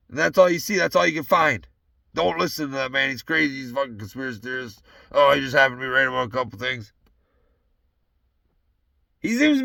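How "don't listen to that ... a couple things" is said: mocking media voice.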